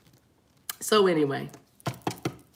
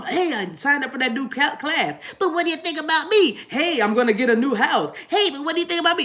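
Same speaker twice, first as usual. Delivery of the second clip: pitched-up mock whine, half laughing; mocking a self-centred partner, whiny; needy whiny voice; 6 semitones higher than usual. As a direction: whine for attention.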